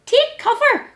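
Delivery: high alarmed little hedgehog; alarmed hedgehog cry. Acted. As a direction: call out the warning. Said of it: "cried little Hedgehog".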